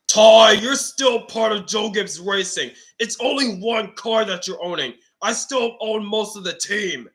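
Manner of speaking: put-on a grandpa setting the boy straight. Grandpa answers Ty.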